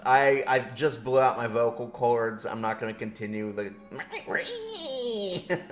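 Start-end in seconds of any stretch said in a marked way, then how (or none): 3.8-5.4 very high, long goblin screech; goblin squeal. Pretend. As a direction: squeal like a little pretend goblin. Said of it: "little goblin voice".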